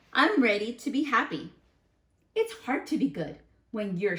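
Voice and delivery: gentle read-aloud voice; reading to children. Acted. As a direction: read it like a picture book to the class. Reads the bad seed's story aloud.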